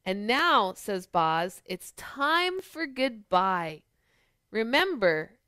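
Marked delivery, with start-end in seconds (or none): none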